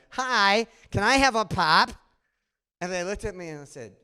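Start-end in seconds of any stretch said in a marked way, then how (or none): 0.1-1.9 louder, hammed-up Midwestern nasal twang; mock nasal Midwestern order shouted across the counter; nasal Midwestern accent. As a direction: play the Midwesterner asking for "a pop?". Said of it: "in my nasal Midwestern accent".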